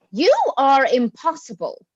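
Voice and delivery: sudden loud, exasperated cry; exasperated outburst. Acted. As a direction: throw it at him, fed up. Daughter snapping at her father.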